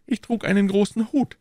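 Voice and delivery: high-pitched character voice; Aramis. A narrator's reading. Narrator voices Aramis in dialogue. (hohe Figurenstimme)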